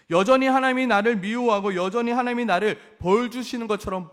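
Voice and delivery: impassioned complaint. Acted. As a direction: build the grievance. Preacher voicing people's frustration. (답답함을 크게 토로하듯)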